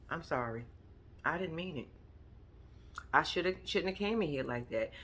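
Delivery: soft and low, a genuinely sorry voice; soft sincere apology. Shown as a demonstration. Showing Harry how to simply say sorry, softly and sincerely. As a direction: say it like you truly mean it, softly. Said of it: "just say fucking sorry".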